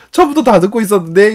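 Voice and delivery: 높고 크게 놀리듯 대답; 아이처럼 목소리를 띄워 약 올리기; 태연한 아이 흉내. Retold or imitated. 엿들은 아이의 대답 재연. (높은 꼬마 목소리)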